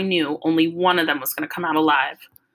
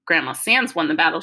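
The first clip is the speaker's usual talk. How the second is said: usual speaking voice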